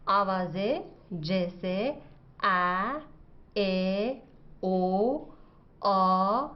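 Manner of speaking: each sound drawn out and spaced; teacher spelling out vowel sounds slowly; slow lesson listing. Shown as a demonstration. Wife teaching husband the vowel sounds, slow and careful. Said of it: "آج کا سبق مختلف آوازوں".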